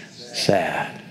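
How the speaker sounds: low, soft, deflated "Sad."; glum sad answer. Retold or imitated. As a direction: let it drop like a sigh. Disciples' answer, voiced low.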